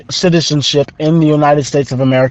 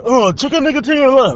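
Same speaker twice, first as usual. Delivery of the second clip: high-pitched gibberish imitating Korean; mock Korean; 8 semitones higher than usual. Student mimicking jogger's fake Korean, mock Korean. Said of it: "tried speaking some fucking English or some fucking Korean".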